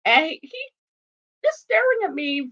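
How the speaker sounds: usual speaking voice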